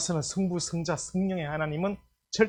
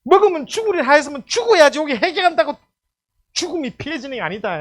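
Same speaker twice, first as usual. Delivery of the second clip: raised, insistent pulpit voice; high, urgent sermon delivery; full-force preaching; 7 semitones higher, 7 dB louder than usual. Real, not acted. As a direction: drive the doctrine home loudly.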